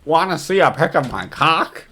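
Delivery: imitating a clumsy, unsubtle come-on; mock blunt blurting. Host mocking suspect's unsubtle chat message, blurted bluntly. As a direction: blurt the question out with no buildup. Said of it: "just blurts it out there. No, no room for imagination".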